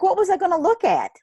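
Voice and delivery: high, laughing disbelief at yourself; quick high burst tipping into laughter; amused self-mockery. Real, not acted. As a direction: laugh at your own silly excuse, pitch up. Mocking her own hesitant reply.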